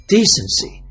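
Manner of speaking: ordinary delivery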